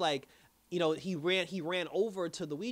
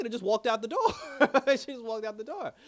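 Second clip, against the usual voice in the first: laughing; 6 semitones higher, 7 dB louder than usual. Real, not acted. Laughs at the walkout.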